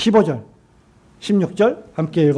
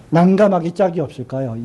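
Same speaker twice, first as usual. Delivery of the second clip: usual speaking voice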